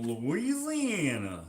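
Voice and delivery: stretched-out mock Southern Louisiana accent; playful Louisiana drawl. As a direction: draw out the state name in a put-on Louisiana twang. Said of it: "What is the Louisiana accent?".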